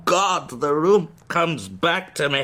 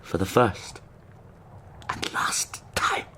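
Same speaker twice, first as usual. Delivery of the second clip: fading hush; 11 semitones lower, 8 dB quieter than usual. Acted. Recalling kissing the dead.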